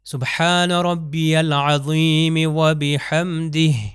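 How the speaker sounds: سبحان ربی العظیم و بحمدہ drawn out slowly, final ہ of بحمدہ breathed clearly; careful Arabic prayer recitation, slow. Shown as a demonstration. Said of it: "اب میں تلفظ کرتا ہوں، آپ دیکھیے".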